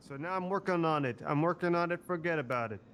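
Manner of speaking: put-on loud, emphatic Italian-American accent. Salvatore insists he is working on it, loud Italian-American accent. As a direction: punch it out like an Italian-American wiseguy. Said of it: "he's losing his Italian accent".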